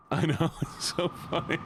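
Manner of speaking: real amused giggle in the words; laughing. Real, not acted.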